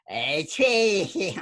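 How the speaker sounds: badly faked Boston accent. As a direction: put on a clumsy Boston accent. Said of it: "here in Boston. We say".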